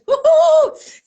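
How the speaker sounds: high, loud woohoo; excited cheer. Real, not acted. Celebrating approved camp.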